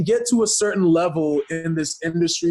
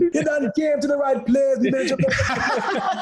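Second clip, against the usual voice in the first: high, hammed-up Jamaican accent; 10 semitones higher than usual. Wrestler delighting his co-hosts, in a hammed-up Jamaican accent. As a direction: crow it in playful Jamaican, much higher. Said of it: "drop the accent".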